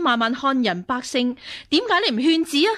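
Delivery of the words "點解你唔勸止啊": higher, louder, fast reproach; confronting the empress over the killings; angry demand. Acted. Nun accusing the empress. (嬲嬲地質問)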